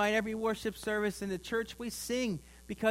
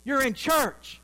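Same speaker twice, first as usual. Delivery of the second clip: higher, louder mock scolding; loud stage-whisper scolding; 5 semitones higher, 7 dB louder, slower than usual. Mother scolds her smiling child in church, loud mock reprimand. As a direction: scold like a mother everybody can overhear. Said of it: "in a stage whisper that everybody could hear".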